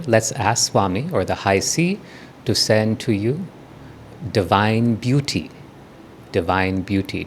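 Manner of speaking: softening, spaced-out phrases with long pauses; guided meditation. Real, not acted. Teacher asks for divine beauty to flow, quiet guided meditation. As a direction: guide them quietly, letting each phrase settle.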